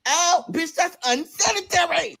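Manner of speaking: high, drawn-out outraged protest; appalled objection. Retold or imitated. Protesting washing in hand sink.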